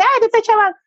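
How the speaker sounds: high, loud whining complaint; child complaining to a parent, high and loud; whiny child. Retold or imitated. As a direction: whine like a bugged kid. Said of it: "a veces me dice".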